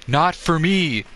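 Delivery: a hint of Vin Diesel, lightly mimicked; mocking the star's odd line reading; Vin Diesel impression. Streamer mocking Vin Diesel's odd line delivery, lightly imitated. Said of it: "really fucking weird how he says it".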